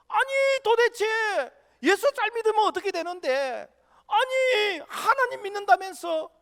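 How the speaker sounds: very high, loud incredulous outcry; exasperated protest. Acted. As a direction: act out a bewildered believer demanding answers. Voicing people's protest at the deaths. (어이없어 높고 크게 항의하는 말투)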